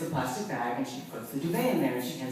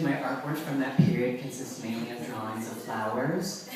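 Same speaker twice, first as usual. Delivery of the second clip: usual speaking voice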